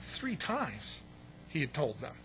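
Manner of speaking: normal talking voice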